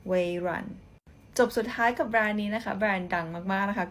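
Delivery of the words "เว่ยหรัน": "เว่ยหรัน" said as Mandarin wei-ruan; "เว่ยหรัน" in Chinese tones; Mandarin reading. Shown as a demonstration. Teacher giving the Chinese name for Microsoft, Mandarin tones. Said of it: "เขาอ่านว่า".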